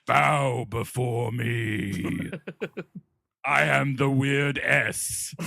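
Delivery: slow, deep, commanding ruler voice; lordly and slow; dark lord. Pretend. Mayor of Cool World speaks.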